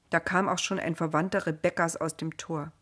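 plain reading voice

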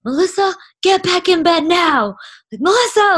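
high, loud panicked command; retold terrified order. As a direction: order the sleepwalker to bed while scared to look. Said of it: "'cause I was terrified".

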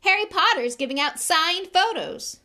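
high, loud taunting announcement; bully's public jeer; roar. Acted. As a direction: bellow it mockingly to a crowd. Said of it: "Malfoy roared to the crowd".

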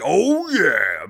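loud, drawn-out, swooping cheer; energized. Retold or imitated. Viewer pumped up by the movie, energized. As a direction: let it swell into a pumped-up yell. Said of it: "it's energized me".

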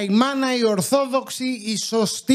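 slow, high, solemn proclamation; dramatic announcement. Acted. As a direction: declaim it like a grand title. Dramatic show opening line.

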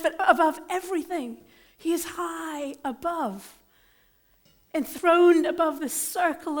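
loud, rising sermon build; booming pulpit crescendo; full-force preaching. Acted.

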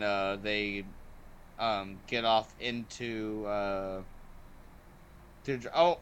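ordinary talk

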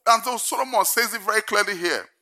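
usual speaking voice

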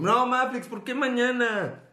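high, drawn-out mock whine; mock protest. Viewer protesting waiting until tomorrow, high mock whine. Acts out a whining reply.

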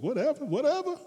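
very high dismissive Whatever, whatever; shrugging off strangers on the street. Retold or imitated. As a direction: brush them off. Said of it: "you're like".

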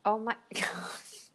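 whispered, laughing through oh my gosh; teasing whisper. Real, not acted. Whispering to co-host, mocking his French sentence. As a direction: whisper the tease to your co-host.

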